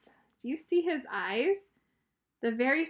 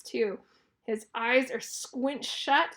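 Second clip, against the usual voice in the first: ordinary delivery, nothing marked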